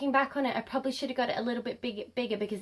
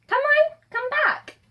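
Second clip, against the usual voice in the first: high, loud coaxing call; 7 dB louder than usual. Real, not acted. Vlogger calling them back after a car drove by, calling out.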